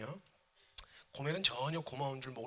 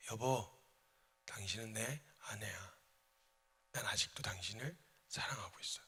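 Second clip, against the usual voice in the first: low, soft, whispered love; husband softly tells his wife he still loves her; tender confession; 5 semitones lower, 9 dB quieter than usual. Acted. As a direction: say it gently to the wife. Says it again.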